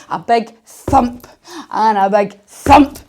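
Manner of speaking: loud, slow stomping call; big thump. Shown as a demonstration. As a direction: boom out each thump. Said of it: "we're gonna thump".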